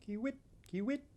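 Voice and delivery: high, soft chirping kiwit; bird call. Acted.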